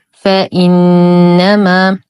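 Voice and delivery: "فإنما" recited slowly, crisp hamza, long hummed نّ; clear hamza. Shown as a demonstration. Teacher shows learners the realised hamza in "فإنما", slow recitation. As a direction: sound the glottal stop in "فإنما", hold the noon. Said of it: "نحقق الهمز".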